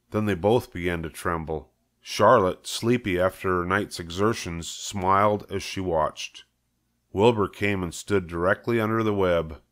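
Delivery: ordinary delivery, nothing marked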